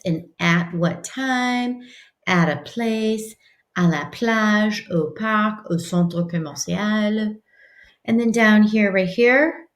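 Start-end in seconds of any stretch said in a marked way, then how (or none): 0.1-7.3 slow teacher reading. Shown as a demonstration. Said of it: "teacher reading phrases slowly".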